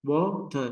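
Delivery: WATER broken in two, wa-ter; water, one syllable at a time; split into syllables. Shown as a demonstration. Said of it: "divided into two syllable".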